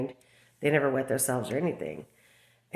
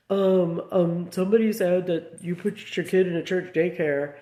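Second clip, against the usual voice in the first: mimicking a hesitant, stuttering liar, raised pitch; louder mock hesitation, stumbling on the ums; imitated stuttering; 4 semitones higher, 4 dB louder than usual. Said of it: "She was, like, stuttering".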